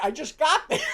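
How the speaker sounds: can't finish for laughing; laughing through it. Retold or imitated. Cracking up retelling the punchline.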